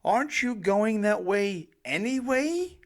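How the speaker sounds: high, loud exasperated yell; yelled in dismay. Retold or imitated. Parent yelling at kids in dismay about the kitchen. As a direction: throw up your hands and yell it. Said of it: "I yelled in dismay".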